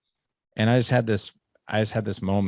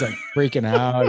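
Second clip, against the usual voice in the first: ordinary delivery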